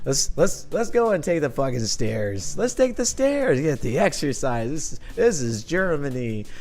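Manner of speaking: put-on drunken rant. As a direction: act out a tipsy, stubborn tirade. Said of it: "So that is Drunk Nick".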